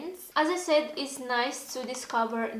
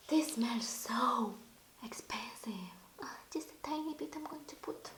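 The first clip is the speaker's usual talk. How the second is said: awed whisper; 5 dB quieter than usual. Real, not acted. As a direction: whisper it like something precious. Awed by the expensive smell.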